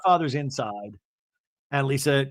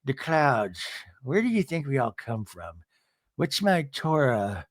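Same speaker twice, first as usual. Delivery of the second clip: Meredith; 2 semitones lower than usual. Retold or imitated. Woman staring off in a pill haze, musing dreamily. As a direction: drift vaguely from thought to thought. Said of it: "a Zanny haze or something".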